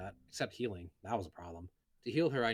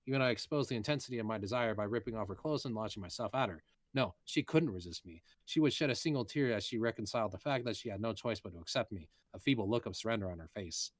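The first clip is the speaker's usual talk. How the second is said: plain reading voice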